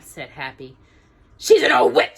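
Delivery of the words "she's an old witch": loud emphatic dwarf; loud gruff dwarf accusation. A narrator's reading. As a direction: spit it out as Grumpy. Grumpy condemns the queen.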